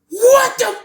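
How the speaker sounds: very high-pitched imitated shriek; retold scream. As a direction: shriek it like a horrified man caught off guard. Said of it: "He start, he screamed".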